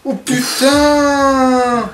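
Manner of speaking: drawn-out scared cry; slow terrified exclamation; scared horror game streamer. Real, not acted. Monster appears in front. (juron terrifié et traînant)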